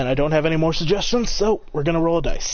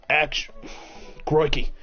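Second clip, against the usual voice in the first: Australian-ish crikey. Acted. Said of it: "an Australian-ish accent".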